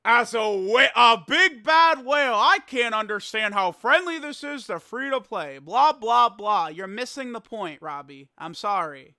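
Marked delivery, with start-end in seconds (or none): 0.0-6.7 loud mock commenter voice; mocking quote. Streamer mimicking a free-to-play apologist, loud and mocking. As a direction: parody the commenter loudly, trailing into blahs.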